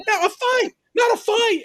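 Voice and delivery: high mock British voice, correcting; hammed-up imitation of a British accent; Allison's British accent. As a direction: pitch way up and put on the accent. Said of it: "Allison is like".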